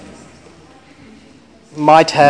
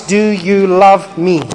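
slow emphatic plea; 3 semitones higher, 5 dB louder, slower than usual. Acted. Slow emphatic question over music.

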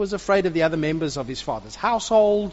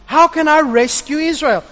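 raised, higher protesting voice; protesting Gideon; 6 semitones higher, 3 dB louder than usual. Acted. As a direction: argue you're too small. Gideon protests his weakness.

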